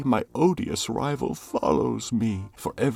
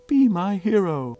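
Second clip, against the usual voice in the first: higher and louder, a swooning romantic plea; ardent plea; 10 semitones higher, 7 dB louder than usual. Acted. Lover pleading with Atalanta, high and loud. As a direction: declaim it like a lovesick hero. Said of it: "said I".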